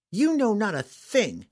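put-on high, slow and scornful young girl; girl spitting words. A narrator's reading. Captive girl spits defiance at her captor, high and slow. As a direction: spit each word at him, a girl refusing to give in. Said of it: "Canterbury spat in his face".

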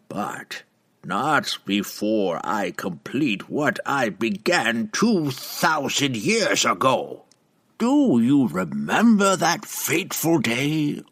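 slow, high, menacing witch; menacing old witch. Acted. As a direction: promise his ruin slowly. Benray's voice.

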